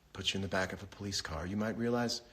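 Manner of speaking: normal talking voice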